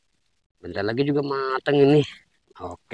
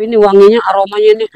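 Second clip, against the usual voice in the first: excited; 12 semitones higher, 7 dB louder, faster than usual. Real, not acted. Sniffing the grilled chicken aroma.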